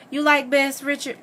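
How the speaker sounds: high-pitched, abrupt question; sudden question. A narrator's reading. Missus Moss abruptly sounding out Richard about Bess, sudden question. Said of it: "asked me suddenly".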